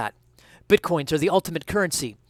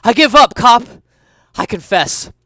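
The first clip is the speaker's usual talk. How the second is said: shouted surrender; 9 semitones higher, 13 dB louder than usual. Acted. Thief surrenders, still shouting.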